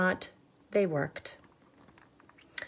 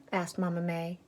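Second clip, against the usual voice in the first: ordinary delivery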